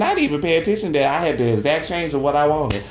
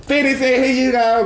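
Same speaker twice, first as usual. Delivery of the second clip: high, loud, eager; all excited; 11 semitones higher, 4 dB louder than usual. Retold or imitated. To the cashier, handing over change, high excited. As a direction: bounce with joy handing it over. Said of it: "Be all excited".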